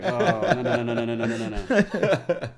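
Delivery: loud, high, rattled-off run of no's; hammed-up backpedalling over laughter; mock panic. Comic reacts to crowd rejecting comedy, mock panic.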